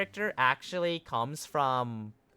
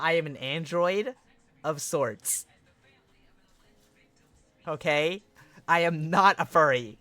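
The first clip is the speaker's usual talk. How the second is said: usual speaking voice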